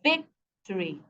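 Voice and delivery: victory split after a stressed VIC; first-syllable stress. Shown as a demonstration. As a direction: hold VIC apart, then let the rest of victory fall. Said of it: "stress here is on the first syllable".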